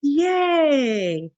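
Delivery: happy cheer to a dog; cheerful praise. Retold or imitated. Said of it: "I'm like".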